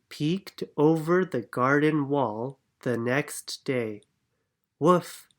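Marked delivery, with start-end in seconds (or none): 4.8-5.3 bright little woof; dog bark. Acted. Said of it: "said George".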